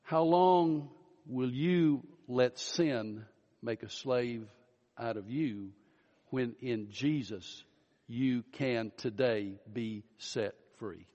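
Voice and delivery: slow, soft, searching plea; quiet pastoral appeal to the congregation; earnest appeal. Acted. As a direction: draw each listener in gently.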